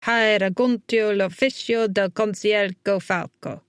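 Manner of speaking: slow Italian recorded-message voice; Italian voicemail greeting. A narrator's reading. Councilman's voicemail greeting a caller, slow Italian. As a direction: recite it slowly as an answering machine.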